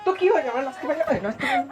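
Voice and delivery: fast, rising high and eager; excited. Real, not acted. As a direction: push her playfully, rising. Excited teasing.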